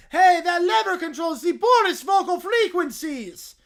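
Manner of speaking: cartoon villain suddenly panicking; alarmed Doofenshmirtz. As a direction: yell at the sabotage.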